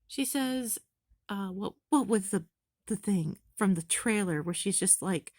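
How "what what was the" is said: normal talking voice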